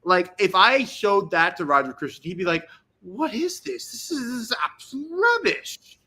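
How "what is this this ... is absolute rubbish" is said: much higher, mock posh English accent; a put-on English accent, high and scornful. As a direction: play the scoffing British director, in an English accent. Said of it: "I can't do an English accent".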